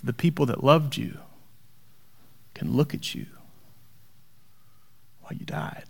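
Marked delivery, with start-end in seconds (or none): 2.5-5.9 unvoiced dying whisper; hushed whisper at the end of the line. Real, not acted. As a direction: fade the line out to breath. Parallel line, voice drops away.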